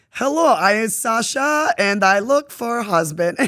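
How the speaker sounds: slow, loud and high, a clumsy fake Russian accent; bad Russian accent. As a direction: ham up a beginner's Russian accent, slowly. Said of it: "it is the worst accent ever".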